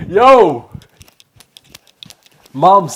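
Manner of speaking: loud, bright shout to his mum; calling out. Real, not acted. Son calling his mother to share his BTS news, loud. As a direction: call her across the house, full voice.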